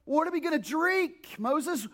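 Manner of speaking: high, whiny demand; complaining Israelites. Retold or imitated. Israelites complaining to Moses about water, high whine. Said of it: "And they're like".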